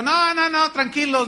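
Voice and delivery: loud, high call across the water. Retold or imitated. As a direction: shout to calm the disciples. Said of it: "Jesús les gritó".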